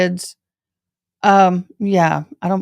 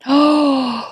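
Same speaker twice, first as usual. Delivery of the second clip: surprised gasp; 7 semitones higher than usual. Real, not acted. Reacting to viewer comment.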